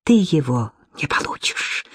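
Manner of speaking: soft, voiceless menacing whisper; spiteful whisper. A narrator's reading. Lidia to rival Sofya, spiteful whisper. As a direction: hiss a threat at your rival. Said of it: "злобно сощурилась".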